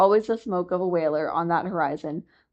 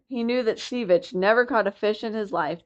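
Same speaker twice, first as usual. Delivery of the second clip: plain reading voice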